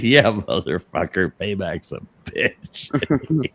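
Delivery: slow, soft, savoring taunt; telling Dave the payback at the engagement party; gloating revenge. Retold or imitated. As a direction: drag it out with smug menace. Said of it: "I said".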